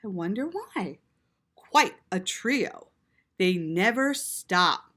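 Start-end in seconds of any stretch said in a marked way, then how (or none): none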